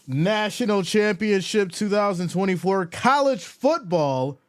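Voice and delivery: big, high announcer opening; announcer intro. Acted. Streamer opening his show like a sports announcer. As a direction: hype up the stream like a broadcaster.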